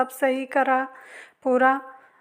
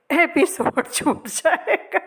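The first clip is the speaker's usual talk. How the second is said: laughing; 5 semitones higher, slower than usual. Real, not acted. Laughs mid-line while talking.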